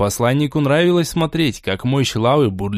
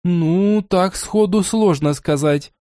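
higher, hedging fairy voice; evasive; 5 semitones higher, slower than usual. Acted. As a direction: dodge the question lightly. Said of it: "уклончиво ответила она".

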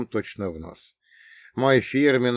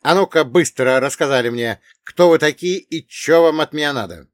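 loud, demanding questioning; voice not his own; 5 semitones higher, 8 dB louder than usual. Acted. Narrator confronting three intruders, loud unnatural voice. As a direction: demand answers, raised voice. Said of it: "не своим голосом сказал я".

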